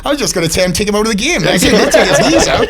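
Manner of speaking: loud, high-pitched laughing disbelief; high, cracked-up objection; laughing protest. Real, not acted. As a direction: protest through real laughter, voice shooting up. Reacting in laughter to a joke.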